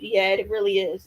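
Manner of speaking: normal talking voice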